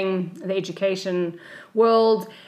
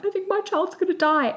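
tearful panic; 12 semitones higher than usual. Retold or imitated. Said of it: "I just burst into tears".

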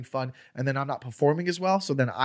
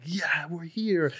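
higher, drawn-out mock cheer; imitated excited fans; 4 semitones higher, much slower than usual. Fans arriving for famous headliner, excited cheer. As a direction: voice thrilled fans in a long cheer.

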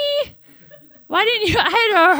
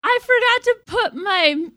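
normal talking voice

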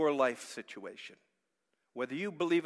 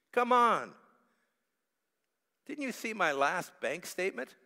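complaining prayer; 4 semitones higher, 6 dB louder than usual. Acted. Voicing a complaining prayer to God.

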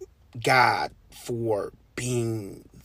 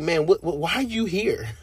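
mock disbelief; 4 semitones higher, 3 dB louder than usual. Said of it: "I'll be like".

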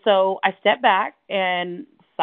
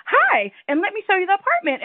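high, beaming sales voice; overly smiley agent; 11 semitones higher than usual. Retold or imitated. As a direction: gush like a too-cheery agent. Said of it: "smiling so much".

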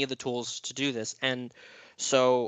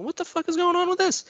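much higher, bewildered cry; startled outburst. Retold or imitated. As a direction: yelp it out, baffled by the gurgling toilet. Retelling panic at the bubbling toilet.

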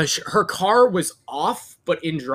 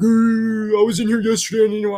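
whiny customer excuse; 5 semitones higher, 4 dB louder than usual. Retold or imitated. Customer excuse retold in story.

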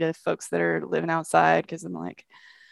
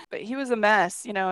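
ordinary delivery

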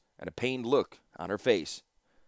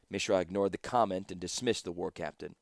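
usual speaking voice

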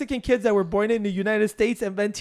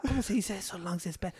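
much softer aside while hunting a word; acted under-the-breath word search; halting translator imitation; 3 semitones lower, 13 dB quieter, faster than usual. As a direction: mutter the question to yourself. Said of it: "he goes like this".

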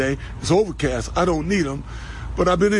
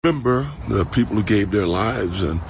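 much lower, heavy mournful voice; sad voice; 8 semitones lower than usual. Acted. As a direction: sink into mock grief. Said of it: "That's my sad voice".

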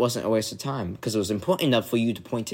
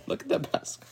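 laughing; 11 semitones higher, 9 dB quieter, faster than usual. Real, not acted. Laughing while teasing.

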